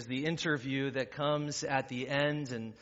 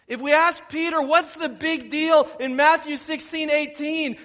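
loud, high, driving sermon delivery; full-force preaching; 13 semitones higher, 13 dB louder than usual. Real, not acted. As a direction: preach it out to the whole room.

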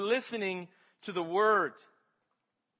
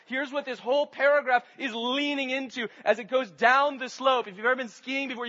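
ordinary delivery

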